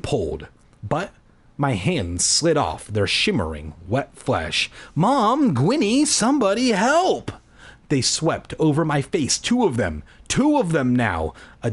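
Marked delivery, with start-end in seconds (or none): 4.9-7.3 panicked cry for help. A narrator's reading. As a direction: call out for anyone to save you. Trapped narrator cries for help.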